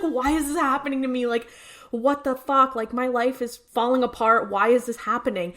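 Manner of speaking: ordinary talk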